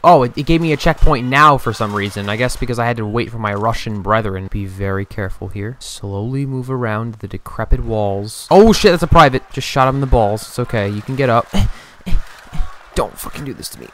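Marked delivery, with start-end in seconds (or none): 4.5-8.5 whisper-quiet careful narration; hushed sneaking. Pretend. Sneaking through a stealth section.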